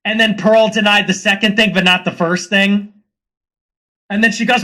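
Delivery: ordinary talk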